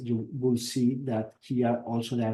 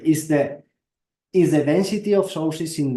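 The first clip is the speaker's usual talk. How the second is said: speaking up, louder; to someone hard of hearing; 4 semitones higher, 7 dB louder than usual. Real, not acted. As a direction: raise the voice so the room hears. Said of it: "Can you speak louder".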